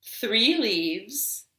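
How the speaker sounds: usual speaking voice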